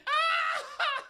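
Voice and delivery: very high, loud shriek through laughter; mock shriek. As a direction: imitate a sudden squealing outburst. Imitates the boyfriend's sudden outburst.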